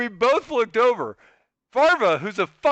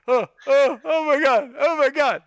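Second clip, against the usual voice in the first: retold dawning panic; 5 semitones higher than usual. Chris realizing, panicked, retold.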